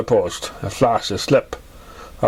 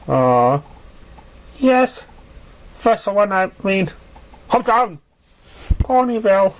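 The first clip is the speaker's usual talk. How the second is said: much higher, halting nervous voice; flustered young pony; 7 semitones higher than usual. A narrator's reading. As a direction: stammer it out, pitched way up and unsure. Nervous young pegasus answering.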